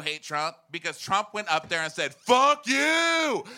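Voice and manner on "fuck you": high, loud, hammed-up yell; defiant mock shout. Comic imitating Trump at a rally, defiant shout. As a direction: shout it as a brash mock challenge. Mimics Trump defying everyone.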